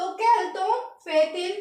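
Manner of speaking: quel temps fait-il slowly, kel-tahn-fay-teel; slow French phrase. Shown as a demonstration. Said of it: "हमें लगाना पड़ता है". (quel temps fait-il धीमे French उच्चारण में)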